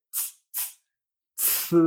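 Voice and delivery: z as "tsʰ" with a burst of air; z hissed out as a strongly aspirated ts; aspirated Chinese C. Shown as a demonstration. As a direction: explode air through the ts of z, tongue up. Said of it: "notar una explosión de aire".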